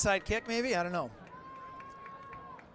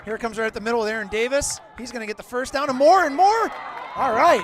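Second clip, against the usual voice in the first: excited play-by-play; 3 semitones higher, 9 dB louder than usual. Real, not acted. Announcer follows Davis to a first down, excited play-by-play. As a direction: call rising higher and louder into a cheer.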